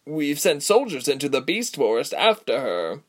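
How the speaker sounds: high, anxious messenger voice; nervous. A narrator's reading. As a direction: stumble in nervously with the report. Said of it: "the messenger interrupted nervously".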